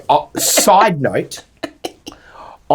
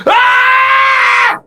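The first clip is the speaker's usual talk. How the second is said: long high scream, mimicking someone; loud, sustained mock shriek; imitated scream; 5 dB louder than usual. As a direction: scream it out like a man winding up the kids. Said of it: "I hear Luke go".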